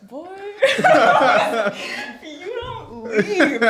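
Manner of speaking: exasperated retort through big laughter; laughing retort. Retold or imitated. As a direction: laugh hard while telling him off. Acted exasperated reply, told laughing.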